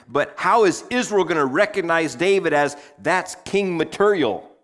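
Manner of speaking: loud, high, driving preacher voice; preaching at full force. Acted. Pastor driving home how hard leading a family is, loud and high. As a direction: push the point hard to the congregation.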